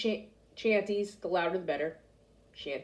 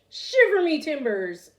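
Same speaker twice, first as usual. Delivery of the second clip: slow, high, louder pirate growl; pirate exclamation; 6 semitones higher, 5 dB louder, slower than usual. A narrator's reading. Pirate exclaims to the boy, slow and loud. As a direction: chew on it like an old sea dog. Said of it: "the pirate said".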